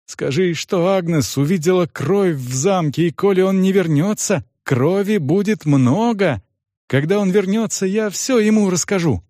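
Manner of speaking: high-pitched urgent message; anxious girl dictating a message; earnest girl. A narrator's reading. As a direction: deliver the warning insistently in a high voice.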